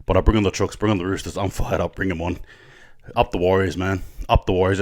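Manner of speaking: low, soft, spent rallying call; subdued sign-off. Real, not acted. Fan signing off after a rant, subdued. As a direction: wind down quietly, pitch dropped.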